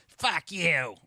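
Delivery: a loud, high mock snap from the wife; wife snapping back. Wife snaps back at husband, loud mock retort. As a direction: bark it back at him.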